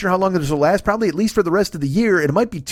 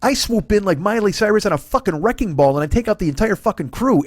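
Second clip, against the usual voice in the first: ordinary talk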